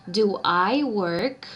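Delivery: slow, careful English, word by word; slow English model sentence. Shown as a demonstration. Teacher to students, reading the example slowly. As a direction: spell it out for the class. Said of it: "quedaría".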